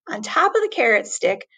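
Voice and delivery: ordinary delivery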